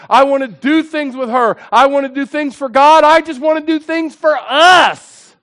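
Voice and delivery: insistent list building in pitch and force; impassioned preaching. Real, not acted.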